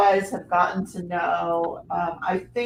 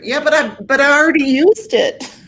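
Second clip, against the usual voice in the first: louder, higher, laughing through it; laughing protest; 5 semitones higher, 6 dB louder than usual. Real, not acted. Host answering about used putty wax, real laughing protest. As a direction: protest while cracking up. Joking excuse amid laughter.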